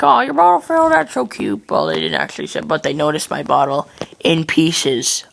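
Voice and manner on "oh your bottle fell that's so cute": high, mock-cooing voice; cooing adult. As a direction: imitate adults gushing over a baby. Adults cooing over crying baby.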